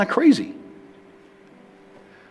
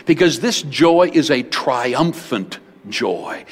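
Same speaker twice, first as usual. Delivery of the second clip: normal talking voice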